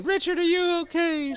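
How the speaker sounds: high-pitched, fretting woman's voice; acted high voice of concern; concerned lady. GM voices lady checking on Richard.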